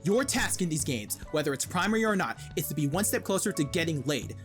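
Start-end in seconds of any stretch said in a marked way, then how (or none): none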